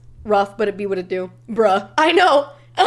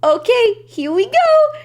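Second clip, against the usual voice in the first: high, loud gleeful here we go; playful announcement; 9 semitones higher, 5 dB louder, slower than usual. Retold or imitated. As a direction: wind up for a big hug. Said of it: "And then he says".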